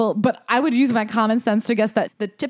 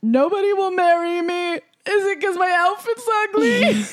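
high, drawn-out mock wail; mock whining; 11 semitones higher than usual. Host mocks the writer fretting nobody will marry her, mock whining. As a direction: whine it slowly, way up high, in exaggerated self-pity.

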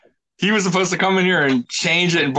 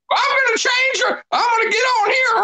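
whiny country voice. Retold or imitated. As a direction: whine it like a country GM. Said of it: "a whiny country voice".

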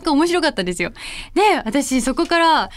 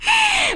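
high burst of laughter. Real, not acted. Laughs at her own story.

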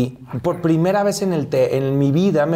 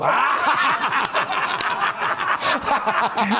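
a long, high burst of real laughter; 10 semitones higher than usual. Real, not acted. Guest laughing at the host's joke about his fan buses. Laughing at childhood bus trips.

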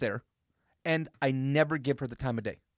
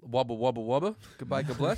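lightly put-on Australian accent; mock Australian accent; 4 semitones lower, 3 dB quieter, faster than usual. Asked to say it again in an Australian accent, mock Australian. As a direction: babble a half-hearted Australian accent. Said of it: "in an Australian accent".

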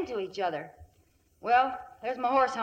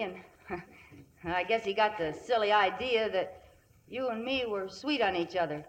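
normal talking voice

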